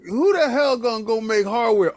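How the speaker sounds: ordinary talk, nothing marked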